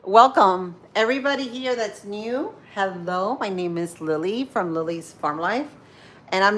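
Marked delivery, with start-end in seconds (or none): none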